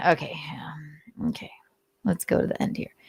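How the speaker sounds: unvoiced whisper to herself; whispered note to self; whispered aside. Real, not acted. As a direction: mutter it under your breath while skimming. Muttering to self while skipping.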